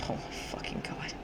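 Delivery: soft breathless whisper; horrified whisper. Real, not acted. Gamer shocked by the death, whispering.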